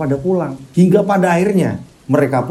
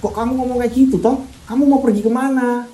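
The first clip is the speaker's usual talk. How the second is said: put-on higher, puzzled boy's voice; a higher voice, confused and worried; suara Anggit bingung; 7 semitones higher than usual. Anggit's voice in the story. (suara anak bingung dan cemas, lebih tinggi)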